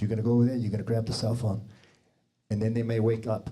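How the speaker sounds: ordinary talk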